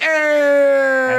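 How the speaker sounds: high, loud grunts on each strike; Oakland sister impression. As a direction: bark it out like a corner coach. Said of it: "She's just like".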